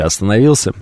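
ordinary delivery

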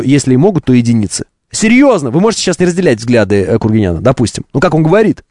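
ordinary talk

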